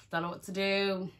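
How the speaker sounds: real laugh breaking through the words. Real, not acted. Telling the viewers about the stuck zip, laughing.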